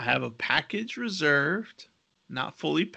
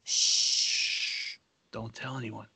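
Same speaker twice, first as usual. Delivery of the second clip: secretive whisper, much softer; 2 semitones lower, 5 dB quieter, slower than usual. Real, not acted. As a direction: hush it like a secret. Shushing about a secret.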